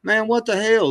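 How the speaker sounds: real outraged surprise; shocked. Real, not acted. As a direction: blurt it out in shock. Reacting to a news headline.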